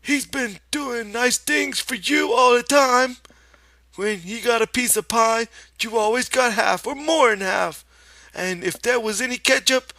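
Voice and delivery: put-on a stern old aunt wagging her finger; scolding aunt in Lenny's voice. A narrator's reading. Aunt Clara scolds Lenny, very high and loud in his voice. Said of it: "she went on in Lenny's voice".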